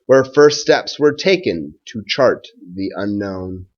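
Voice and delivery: put-on slow, even rhyming read-aloud for children; story read-aloud. A narrator's reading. Teacher reading a Thanksgiving rhyme to his class, gentle storytime read-aloud. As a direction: read it like a picture book at storytime.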